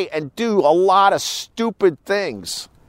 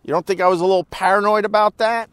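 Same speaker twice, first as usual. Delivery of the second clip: ordinary delivery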